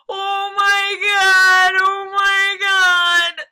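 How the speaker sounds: high, loud, drawn-out wail; anguished wailing; gasping moan. A narrator's reading. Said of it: "uttered over and over in a gasping moan".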